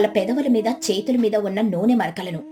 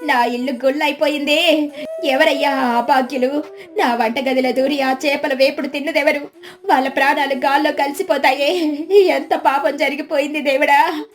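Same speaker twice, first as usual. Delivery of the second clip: high, theatrical mock lament; put-on tearful outcry; fake loud wailing; 4 semitones higher, 3 dB louder than usual. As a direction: wail so the whole village hears. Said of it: "గట్టిగా ఊరంతా వినపడేలా వగరుస్తూ".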